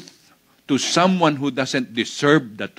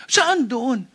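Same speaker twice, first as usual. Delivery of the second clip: raised-pitch pressing question; nosy questioner; 7 semitones higher than usual. Acted. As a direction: insist on the exact place, higher voice. Acting a persistent questioner. (mataas, nangungulit na tanong)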